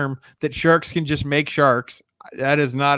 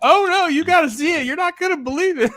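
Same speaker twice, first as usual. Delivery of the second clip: much higher, louder teasing voice; imitating Loki; 4 dB louder than usual. Loki gloating over the phone, high teasing. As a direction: ham up a friend gloating. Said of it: "He's like".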